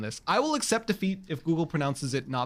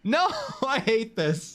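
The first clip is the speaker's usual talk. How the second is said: higher, drawn-out protest; slow wail of mock despair; exasperated; 4 semitones higher, slower than usual. Real, not acted. Streamer losing the pronunciation argument to Google, exasperated.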